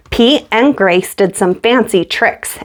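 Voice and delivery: ordinary delivery, nothing marked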